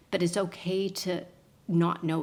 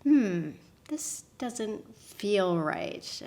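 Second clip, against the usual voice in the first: drawn-out, slow hesitant musing; mock uneasy thought, said slowly; slow uneasy doubt; 2 semitones higher, much slower than usual. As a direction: let the doubt creep in slowly. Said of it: "And I was like".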